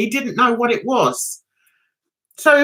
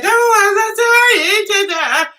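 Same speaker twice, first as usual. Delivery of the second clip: high, loud gushing approach; gushing quote; 9 semitones higher, 4 dB louder, much slower than usual. Retold or imitated. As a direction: pipe up high, putting it on for the policeman. Acts out approaching the cop.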